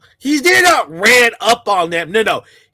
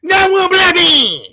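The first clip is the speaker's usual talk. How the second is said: higher, louder whiny mock cry; mocking imitation; 6 semitones higher, 5 dB louder than usual. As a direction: wail it out like a parody of them. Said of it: "That's how these guys talk".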